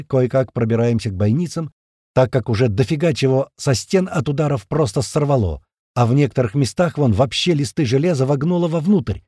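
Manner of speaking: usual speaking voice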